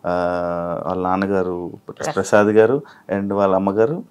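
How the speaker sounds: energetic anchor welcoming viewers; show host intro. Acted. Anchor greeting viewers at the show's start, upbeat host read.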